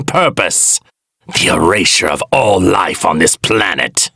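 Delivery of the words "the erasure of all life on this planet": ordinary talk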